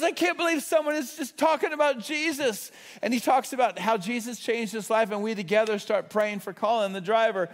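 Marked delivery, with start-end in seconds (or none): 0.0-2.7 amazed delight. Retold or imitated. Curbside worker amazed.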